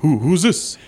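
a big mock New York greeting; Tony Danza impression. Friends summon Tony Danza as a bit, hammed-up tough-guy voice. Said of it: "dial up Tony Danza".

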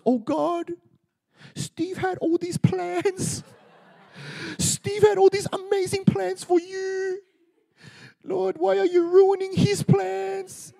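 high, slow, laughing mock lament; mocking friend. Friend mocking Steve's plans, in a high laughing wail. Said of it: "he started mocking me".

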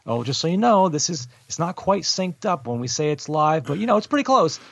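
high, put-on voice of a producer; mock Kevin Dunn. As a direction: ham up the tech guy reassuring her. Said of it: "hear from Dunn that".

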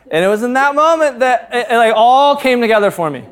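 usual speaking voice

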